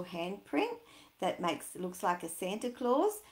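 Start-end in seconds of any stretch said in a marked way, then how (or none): none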